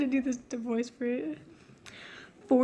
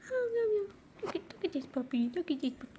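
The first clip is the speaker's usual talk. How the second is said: soft cooing; 3 semitones higher, 9 dB quieter, faster than usual. Real, not acted. Cooing at her puppy.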